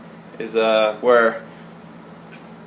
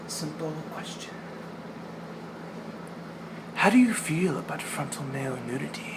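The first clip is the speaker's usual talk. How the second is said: a hint of Monty, softer and confiding; sly hushed quote; 2 semitones higher, 12 dB quieter than usual. Quoting Monty to the new waiter, softly conspiratorial.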